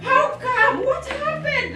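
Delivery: high-pitched outraged cry; outraged devil. Retold or imitated. The devil, furious that the girl escaped the village, outraged cry. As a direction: squeal it like a furious, baffled devil. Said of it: "the devil is so angry".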